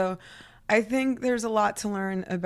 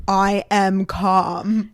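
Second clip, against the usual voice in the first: slow, louder, far from calm; mock calm insistence; 2 semitones lower, 7 dB louder, much slower than usual. Told to calm down, slow and louder in mock protest. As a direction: insist you're calm through clenched teeth. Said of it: "it's like".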